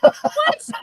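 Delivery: sudden high, loud "What?"; startled protest. Real, not acted. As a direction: burst out in real disbelief. Host says he won't be on.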